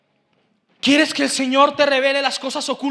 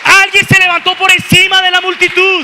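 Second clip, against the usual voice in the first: loud, shouted exhortation; full-force preaching; 4 semitones higher, 11 dB louder than usual. Real, not acted. Preacher urging the church to applaud God, shouting at full force. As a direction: drive the congregation to applause.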